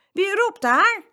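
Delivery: high, loud questioning call. A narrator's reading. Farmer asking who is shouting, loud call. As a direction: call out to someone unseen. Farmer calling toward unseen voices.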